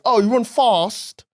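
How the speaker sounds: slow mock southern "Oh, you run fast" with long a in "fast"; mock London accent. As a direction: imitate a Londoner saying "fast" as "fahst". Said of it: "I hate when Londoners".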